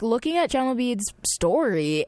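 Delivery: ordinary delivery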